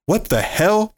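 sudden high startled outburst. A narrator's reading. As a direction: jump at the noise, pitch shooting up. Ben startled in the dark.

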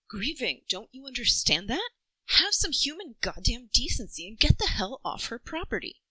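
loud, furious rebuke of the press; an angry aunt shouting off the journalists; angry telling-off. A narrator's reading. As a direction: chase the reporters off her lawn. Kirsty yelling at journalists outside.

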